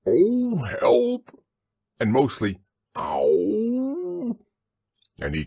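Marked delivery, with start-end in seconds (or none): 0.1-1.4 high, loud, drawn-out cry for help; Pooh inside a jar. A narrator's reading. Pooh calls out from inside the honey jar, high wail. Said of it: "he said inside the jar". 2.9-4.4 long, high "ow"; drawn-out high howl of a stuck bear; Pooh's pained howl. A narrator's reading. Pooh stuck in the honey jar, long high howl. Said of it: "he said inside the jar".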